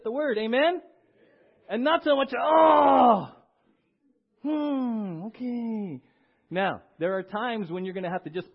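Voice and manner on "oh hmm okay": reluctant groan. Retold or imitated. Reluctant churchgoer voiced.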